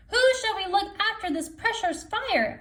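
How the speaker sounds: high Raven voice; Raven asking. Acted. Raven asks who will keep the fire, high. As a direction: Raven calls to all the animals. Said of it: "asked Raven".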